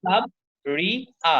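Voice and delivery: সাবরিয়া slowly in three beats, সাব, রি, আ; split into syllables. Shown as a demonstration. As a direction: pause after each syllable of সাবরিয়া. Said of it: "তিনটা".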